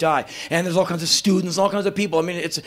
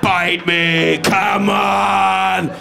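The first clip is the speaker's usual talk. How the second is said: loud, taunting challenge to nature; defiant pyramids; 4 semitones higher, 4 dB louder, slower than usual. Retold or imitated. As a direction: shout down the storm with swagger. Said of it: "the pyramids just go like".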